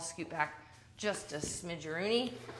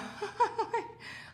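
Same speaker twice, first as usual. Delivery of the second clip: breathy giggle. Real, not acted. Laughs at own joke.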